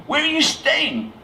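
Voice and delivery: very high, slow, mock-tearful American. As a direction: plead in a hammed-up American accent. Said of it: "with tears in his eyes".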